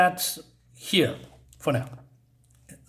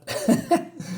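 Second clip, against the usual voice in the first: brief high-pitched chuckle; amused little laugh mid-thought; short laugh; 14 semitones higher than usual. Real, not acted. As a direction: let a small high laugh slip out. Amused while describing odd creature.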